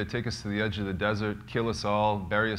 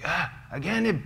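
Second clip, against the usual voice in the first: higher, dismissive foreign-word echo; police chief; 8 semitones higher than usual. Retold or imitated. The police chief to the prisoners, higher. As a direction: brush off the foreigners lightly. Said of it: "he's like".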